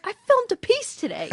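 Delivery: defensive retort. Retold or imitated. Wife voices her own excuse.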